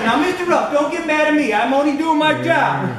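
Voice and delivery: mock southern motel clerk voice, barely holding back a laugh; southern accent. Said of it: "So I try to use a southern ac-, accent".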